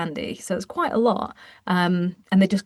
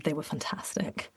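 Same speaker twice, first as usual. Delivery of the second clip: whispered delight; 4 semitones lower, 11 dB quieter than usual. Real, not acted. Remembering squashies after labor.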